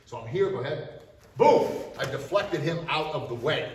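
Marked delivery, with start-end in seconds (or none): 1.4-1.7 sharp shouted boom, high; loud boom. Shown as a demonstration. Instructor deflecting the attacker, shouted boom. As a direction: bark the impact out.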